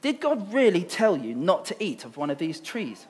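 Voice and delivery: ordinary delivery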